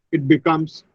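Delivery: usual speaking voice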